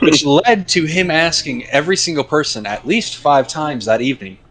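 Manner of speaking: ordinary talk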